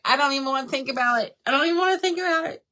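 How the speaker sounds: fast, rising fretful refusal; mock panic. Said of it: "major panic attack".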